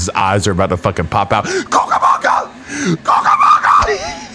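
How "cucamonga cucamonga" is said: hoarse, high screamed Cucamonga; yelling at the top of his lungs. Pretend. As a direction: scream it with eyes popping. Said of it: "Like that, you know?".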